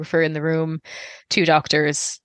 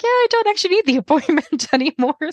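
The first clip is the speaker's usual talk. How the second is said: bright, much higher, laughing through it; breezy relieved quote; 12 semitones higher than usual. Retold or imitated. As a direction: chirp it out, giddy with good news. Ringing to cancel the appointment.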